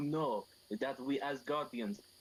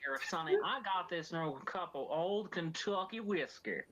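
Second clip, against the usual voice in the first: slow hammed-up Southern drawl; 6 semitones higher than usual. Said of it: "they're rednecks".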